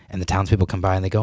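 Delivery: ordinary delivery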